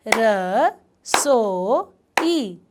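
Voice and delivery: र, सो, ई said apart, one clap each; syllables split apart. Shown as a demonstration. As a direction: clap out र, सो, ई for the class. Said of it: "चलो, पहले मैं करती हूँ". (रसोई को अक्षरों में तोड़कर बोलना)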